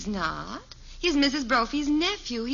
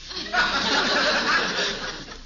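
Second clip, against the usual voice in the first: breathy laugh; 5 semitones higher than usual. Acted. Wife amused by husband.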